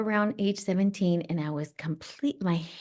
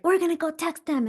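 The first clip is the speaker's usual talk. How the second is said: put-on higher, fast, riled-up teenage girl; angry teen self; 10 semitones higher, faster than usual. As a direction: push to go confront him, quick and heated. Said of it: "to, like".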